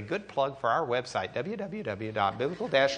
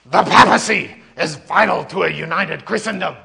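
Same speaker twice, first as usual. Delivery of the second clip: louder, pompous debater; lightly voiced Eck; 4 semitones higher, 11 dB louder than usual. Teacher voicing Eck in the debate, louder and pompous. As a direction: declare it like dogma.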